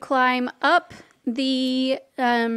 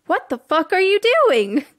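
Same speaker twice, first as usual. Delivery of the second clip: neighbor snapping from the window, louder and higher; angry yell; 7 semitones higher, 5 dB louder than usual. Acted. Neighbor yelling at the player character lurking below her window. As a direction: bark it out at the intruder.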